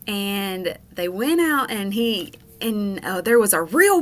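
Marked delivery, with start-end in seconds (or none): none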